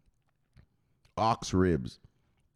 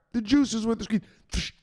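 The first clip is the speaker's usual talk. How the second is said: cowed recital, louder; 14 semitones higher than usual. Acted. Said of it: "Say it louder".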